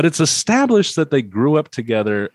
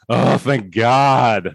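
slow drawn-out mock relief; relieved old folks; much slower than usual. Host mocking the elderly guests, slow and drawn-out relief. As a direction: stretch it out like grateful old people. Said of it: "all the other old people who are there are like".

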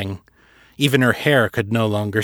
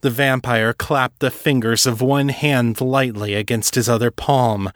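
usual speaking voice

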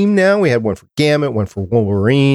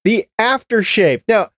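high-pitched comic insistence; playful, high-voiced protest; mock indignation; 10 semitones higher than usual. Real, not acted. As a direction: press the point in a high, incredulous voice. Mock-indignant banter over a joke.

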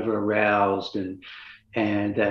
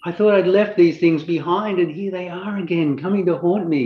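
higher-pitched, mildly alarmed dismay; dismayed inner voice; 7 semitones higher than usual. Retold or imitated. Insomnia sufferer recalls the problem returning, dismayed. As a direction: relive the dread of it coming back.

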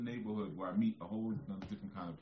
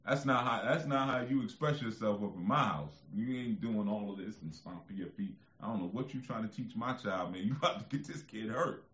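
higher, loud stern scolding; retold scolding; 4 semitones higher, 5 dB louder than usual. Father scolding the video in his house, loud and stern. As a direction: lay down the law to the TV. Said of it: "I was like".

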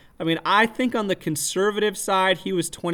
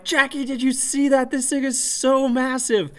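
high, thrilled cry to his wife; excited screaming; 7 semitones higher than usual. Retold or imitated. Calling to his wife about the massive fish, high and thrilled. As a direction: yell up high, amazed at the fish. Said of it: "So I'm still screaming".

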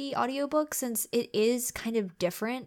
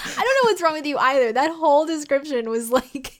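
laughing tease; 6 semitones higher, 8 dB louder than usual. Real, not acted. Teasing back through laughter.

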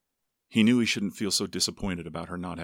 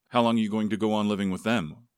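louder, mildly sarcastic jab; understated sarcasm from a disapproving father; 3 semitones higher, 6 dB louder than usual. A narrator's reading. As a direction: needle him with a raised, sarcastic question. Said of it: "Woodrow's voice had turned sarcastic".

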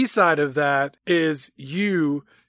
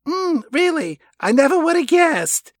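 high mock Stacy; 10 semitones higher, 3 dB louder than usual. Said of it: "Stacy says to him".

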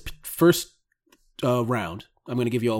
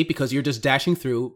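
normal talking voice

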